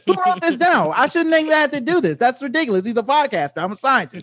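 an annoyed Fauci fed up with the podcast; imitating Fauci. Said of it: "I was like".

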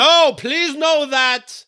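drawn-out, high, mock-earnest plea; slow high put-on voice. Imitating a royal fan, slow and high put-on plea. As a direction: stretch it out high and mock-pleading. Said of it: "someone's like".

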